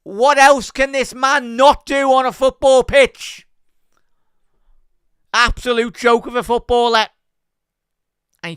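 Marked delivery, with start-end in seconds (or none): 0.0-7.1 amazed praise. Real, not acted. Fan to chat, loud and awestruck at the player. Awe after the near miss.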